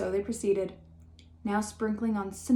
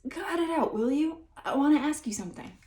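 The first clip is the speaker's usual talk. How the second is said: quivering hearty; 6 semitones higher than usual. A narrator's reading. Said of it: "in a hearty, quivering voice".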